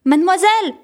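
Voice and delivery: schoolboy calls out to his teacher in alarm; calling out. A narrator's reading. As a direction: call the teacher in a panic. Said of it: "a crié Geoffroy".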